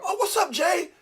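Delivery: mock friendly greeting. Mocking fair-weather friends' greeting.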